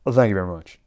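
a quick bad Elvis catchphrase; [name] impression. Zombie Elvis signing off, in a put-on Elvis voice. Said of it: "[name] voice".

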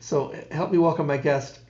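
ordinary talk

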